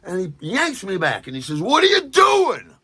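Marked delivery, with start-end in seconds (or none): 1.6-2.7 high loud slow yell of an angry bartender; bartender's angry shout. Retold or imitated. Bartender yelling at the writer. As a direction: bellow it like a suspicious bartender.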